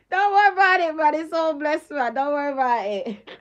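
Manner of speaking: brushing it off while cracking up; laughing. Real, not acted. Telling a former partner not to worry, laughing. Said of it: "I really can't stop laughing".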